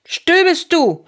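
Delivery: high, loud, slow annoyed yell; angry shout. A narrator's reading. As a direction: shout it crossly at a whining friend. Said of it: "schrie Fritz ärgerlich". (argerlich schriegen)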